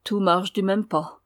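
ordinary delivery

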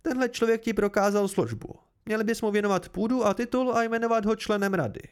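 high-pitched, heated urging; insistent noblewoman. Acted. Meluan argues with husband. (naléhavá šlechtična, vyšším hlasem)

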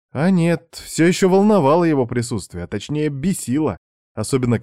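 ordinary delivery, nothing marked

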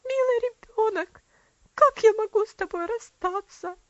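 put-on a sister wails over the dying girl; sobbing. A narrator's reading. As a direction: cry out through sobs. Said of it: "безутешно разрыдавшись, она воскликнула".

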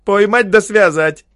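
high, loud jeering call; loud mocking cry from the crowd; mocking shout. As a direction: egg the crowd on with a taunt. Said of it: "насмешливый бодрящий возглас".

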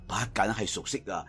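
plain reading voice

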